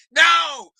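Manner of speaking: normal talking voice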